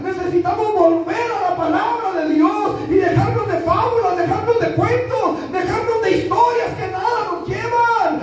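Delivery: very high, fervent exhortation; full-force preaching. Real, not acted. Preacher urging today's church back to the Scriptures, impassioned preaching. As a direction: preach it urgently at a sustained high pitch.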